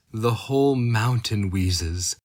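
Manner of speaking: usual speaking voice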